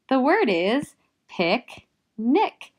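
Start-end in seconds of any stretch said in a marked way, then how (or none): 1.3-2.7 "picnic" as pic, pause, nic; slow "picnic", syllable by syllable; split syllables. Shown as a demonstration. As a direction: break "picnic" into two slow, loud beats. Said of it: "syllable break happens right after the C".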